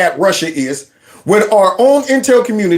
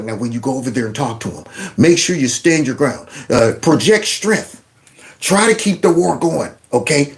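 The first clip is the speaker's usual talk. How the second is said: mock advising leaders; 7 semitones lower, 3 dB quieter than usual. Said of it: "leaders probably was like".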